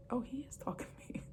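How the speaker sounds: quick voiceless whisper of sudden realization. Retold or imitated. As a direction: breathe it out fast as it dawns on you. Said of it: "I'm like".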